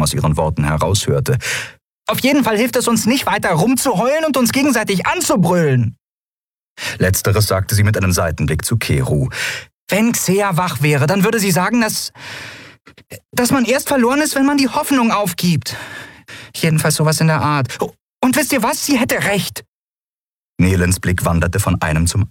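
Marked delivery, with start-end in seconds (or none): 2.1-6.0 very high-pitched, defiant retort; high voice, cheeky and combative; feisty small heroine. Acted. 9.9-19.6 very high voice, rallying the crew; high-pitched, determined encouragement; earnest small heroine. Acted.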